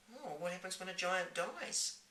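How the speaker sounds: put-on young girl voice. Sophie asks.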